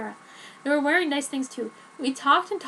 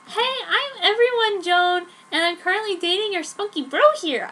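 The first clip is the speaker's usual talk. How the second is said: put-on higher, louder teen girl voice; Joan's voice; 7 semitones higher, 5 dB louder than usual. Joan gushing to Dad about her boyfriend, higher. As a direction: gush like a lovestruck teen. Joan greets Edward's family.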